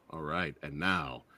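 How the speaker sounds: ordinary talk, nothing marked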